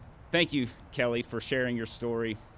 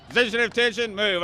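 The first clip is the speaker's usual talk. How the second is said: loud, higher command, Move hit hard; drill command; 9 semitones higher, 6 dB louder than usual. Shown as a demonstration. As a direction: order the formation to attention. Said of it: "so everybody stand up".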